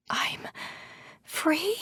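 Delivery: hushed, halting, tiny voice lifting high; soft, disbelieving whisper; whispered question. A narrator's reading. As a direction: whisper it hesitantly, rising on the last word. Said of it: "I whisper".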